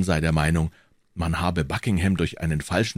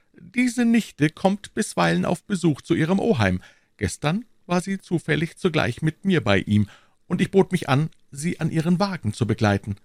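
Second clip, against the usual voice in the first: high-pitched storytelling character voice; Aramis; 10 semitones higher than usual. Acted. Aramis telling his friends about the niece, high-pitched. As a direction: tell it in a high, lifted character voice.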